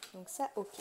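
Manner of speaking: ordinary delivery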